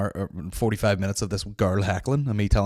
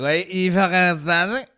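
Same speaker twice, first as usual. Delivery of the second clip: high, louder disbelieving mock; incredulous heckler; 9 semitones higher, 7 dB louder than usual. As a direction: sneer it in disbelief. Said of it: "it's like".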